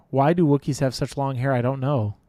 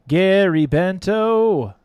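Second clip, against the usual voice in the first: drawn-out loud call; 4 semitones higher, 9 dB louder, slower than usual. Real, not acted. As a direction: call the name out slow and loud. Calling the winner's name again.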